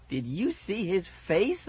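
high, slow, gleeful drawn-out question; thrilled, stretched-out yelp; happy yelp. A narrator's reading. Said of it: "I yelped happily".

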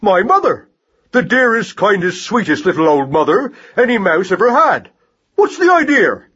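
indignant mouse tirade, rising on outbursts; raging torrent. A narrator's reading. Mouse berating rat-catcher for threatening his mother, indignant tirade. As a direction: scold hotly without letting up. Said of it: "positive raging torrent of words".

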